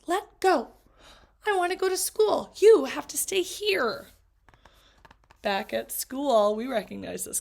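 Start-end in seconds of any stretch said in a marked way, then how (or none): 0.1-4.1 higher, pleading and insistent; pleading child. Acted. Girl pleading with her pet to stay home. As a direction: beg the pet to let go.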